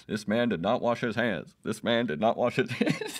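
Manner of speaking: lightly voiced public announcement, cracking up at the end; mock bullhorn announcement. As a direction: announce it to the whole mall, barely holding the laugh. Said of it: "announce to the fucking mall".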